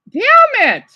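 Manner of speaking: sudden high, loud "damn it"; excited outburst. Real, not acted. Vlogger watching Canada Day video, excited high outburst. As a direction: blurt it out, overwhelmed by the parade.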